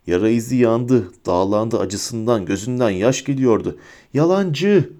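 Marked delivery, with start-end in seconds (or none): 4.1-4.9 high, contained scream. A narrator's reading. Bellatrix refuses to believe Harry, mildly screaming. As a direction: cry out the accusation high, half volume. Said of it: "diye çığlık attı Bellatrix".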